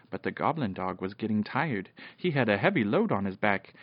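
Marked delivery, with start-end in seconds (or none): none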